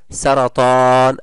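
سرطان read slowly, the long alif stretched; slow drawn-out vowel. Shown as a demonstration. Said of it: "مد ألف وقبلها فتح". (مدّ طويل بطيء)

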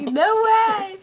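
high, drawn-out mock amazement; mock disbelief. Retelling her reaction to friend, mock disbelief. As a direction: stretch it high as if astounded.

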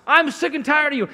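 high-pitched mock scolding of a spouse; acted fed-up outburst. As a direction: burst out as if fed up, half in jest.

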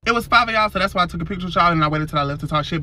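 ordinary talk

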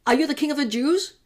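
questioning governor. Acted. Pilate questions Jesus.

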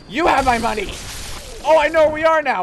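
very loud, high yelling throughout; triumphant screaming. Real, not acted. Player screaming at the enemy holding his souls, frenzied shouting. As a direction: roar it out in a frenzy of rage and triumph.

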